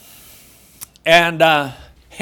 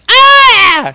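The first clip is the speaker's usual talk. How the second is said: shrill startled shriek, much higher and louder; a sudden high, piercing scream; 10 dB louder than usual. Retold or imitated. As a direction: let out a frightened shriek at the spider. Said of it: "She screams".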